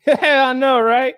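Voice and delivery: high, loud, laughing mock reply; laughing cheater quote. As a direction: giggle through it like a cheeky cheater. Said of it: "He's like".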